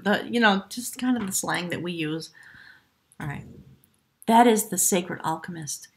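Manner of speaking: ordinary delivery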